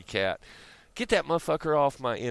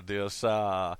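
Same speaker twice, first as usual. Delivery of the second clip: mock British toff; slower than usual. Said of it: "watching way too much fucking Craig Ferguson".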